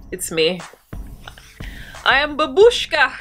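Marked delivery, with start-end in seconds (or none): 2.0-3.1 hammed-up Russian babushka voice, higher and louder; mock Russian accent. Woman in a satin scarf playing babushka, mock Russian accent. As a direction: play a loud, high-pitched Russian granny.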